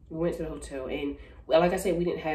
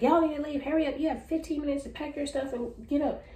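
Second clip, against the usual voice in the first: retold bossy order; 12 semitones higher than usual. Woman with gun giving tenants a deadline, high mock-bossy order. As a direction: mimic a woman hurrying people out.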